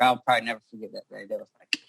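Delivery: ordinary talk, nothing marked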